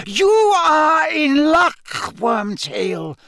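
very high, loud, drawn-out villain voice; icy master pitched far above normal; cold, high-pitched voice. Acted. Voldemort to Wormtail from the chair, cold high-pitched voice. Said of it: "said a cold, high-pitched voice".

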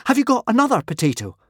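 high, loud and fast, eager; sudden hope. A narrator's reading. Hiccup asks Norbert for another potato, hopeful. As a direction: blurt it out hopefully. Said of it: "in sudden hope".

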